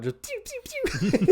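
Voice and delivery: very high, slow laughing pew sounds; blaster noises. Retold or imitated. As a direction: imitate laser shots. Mimicking blaster shots.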